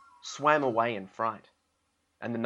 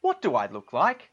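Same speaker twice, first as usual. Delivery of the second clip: calling out; 4 semitones higher, 6 dB louder than usual. A narrator's reading. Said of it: "called out".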